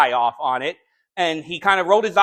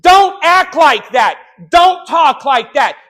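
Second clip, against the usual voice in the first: slow, shouted commands, much higher; in-your-face rebuke; 11 semitones higher, 9 dB louder, slower than usual. Acted. Preacher rebuking church members to their face, shouting. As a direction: bark each order right in their face. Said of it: "get in your face and say".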